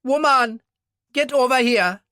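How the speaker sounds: high, loud call across the bar. Acted. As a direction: holler for his wife across the room. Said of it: "called to his wife".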